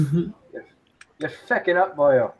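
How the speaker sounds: slow, drawn-out mock Irish; a hint of a slow Irish brogue; mock Irish accent. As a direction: drag out the words like a stage Irishman. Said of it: "I'm too Irish".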